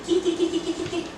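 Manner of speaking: high, soft kitty call; sing-song call to a cat. Real, not acted. Calling cat down.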